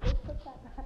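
telling his bros loudly to return the stuff; loud order. Retold or imitated. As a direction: order them firmly to put it back.

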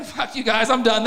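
normal talking voice

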